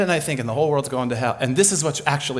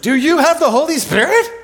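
much higher and louder astonished question; amazed question; 13 semitones higher, 8 dB louder than usual. Retold or imitated. Peter and Cornelius asking each other, amazed and loud. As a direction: burst out in amazement. Both men amazed at the Spirit.

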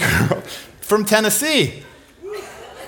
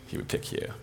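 whispered, very soft; hushed reassurance; 16 dB quieter than usual. Real, not acted. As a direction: whisper it as a gentle assurance. Intimate reassurance to the audience.

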